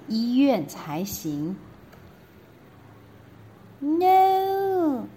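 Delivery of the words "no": put-on high, loud childish wail; princess cries no. Princess refusing the doctor, high loud wail. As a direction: cry it out like a stubborn little girl. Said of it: "cried the princess".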